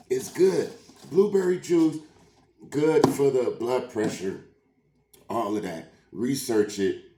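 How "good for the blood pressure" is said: ordinary delivery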